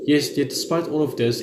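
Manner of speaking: ordinary talk, nothing marked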